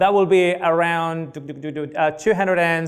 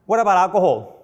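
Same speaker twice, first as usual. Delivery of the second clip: a touch louder, aimed at the husband; lightly voiced wife nudging him; wife's pointed question; 3 semitones higher, 4 dB louder, faster than usual. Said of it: "the wife said to me".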